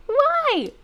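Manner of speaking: high, loud disbelief; exasperated outburst. Real, not acted. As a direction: shriek it in disbelief.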